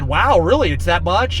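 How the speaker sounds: sarcastic wow, really; mock surprise. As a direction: feign amazement at the figure. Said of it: "And then I said".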